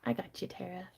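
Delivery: whispered I got you; soft reassurance. Real, not acted. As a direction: reassure her gently.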